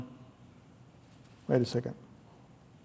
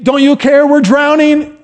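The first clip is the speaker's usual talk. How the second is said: high, strained cry of frightened men; panicked disciples; 9 semitones higher, 4 dB louder than usual. Acted. Disciples crying out to sleeping Jesus, high and panicked. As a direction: plead as if the boat is sinking.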